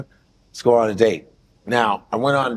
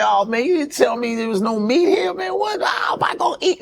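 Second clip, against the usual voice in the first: high, joking mock complaint that jumps to a squeaky yell; mock outrage; 10 semitones higher than usual. Joking with the waiter about no meat, hammed-up outrage. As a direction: ham up fake horror at no meat. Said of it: "I'm joking with her".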